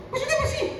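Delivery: a mock listener piping up; high squeaky interjection. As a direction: squeak the question very high and soft.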